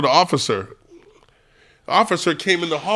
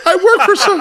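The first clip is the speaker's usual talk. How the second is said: high, loud mock begging; hammed-up panicked excuse; acting move; 13 semitones higher, 6 dB louder than usual. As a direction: plead in a put-on frightened squeal. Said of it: "like, acting move".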